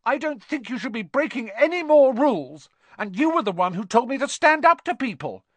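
high, loud, worked-up argument; indignant protest. A narrator's reading. Neville standing up to people, indignant protest. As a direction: argue back, voice pitched high. Neville argues back in quarrel.

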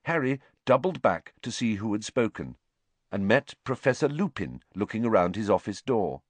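usual speaking voice

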